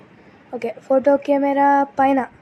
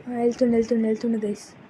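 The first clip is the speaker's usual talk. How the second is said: hushed whisper; 4 semitones lower, 6 dB quieter, faster than usual. Real, not acted. Hiding while the enemy passes.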